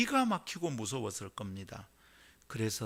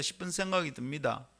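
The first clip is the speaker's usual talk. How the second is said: ordinary talk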